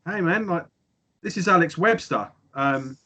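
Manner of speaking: a fan retelling a famous caller's voice; imitating caller. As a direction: put on a breezy stranger's voice on the phone. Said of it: "he goes".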